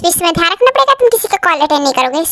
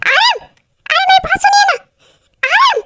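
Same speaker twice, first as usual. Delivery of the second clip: panicked calling. Acted. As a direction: cry out for help in alarm. Panicked calls to [name]. (ऊँची, घबराई हुई पुकार)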